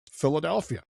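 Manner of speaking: ordinary talk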